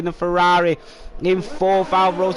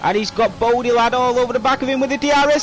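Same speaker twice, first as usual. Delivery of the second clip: rising, louder, higher play-by-play; excited commentator; 9 semitones higher, 4 dB louder than usual. Real, not acted. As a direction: call the overtake with building excitement. Live overtake call in race.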